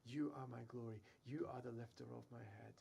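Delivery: ordinary delivery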